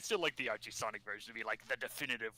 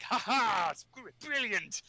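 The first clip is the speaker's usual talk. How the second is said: high, gleeful evil scientist; villain Robotnik voice; 10 semitones higher, 5 dB louder, slower than usual. Retold or imitated. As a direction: gloat like a cartoon villain. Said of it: "And Robotnik's like".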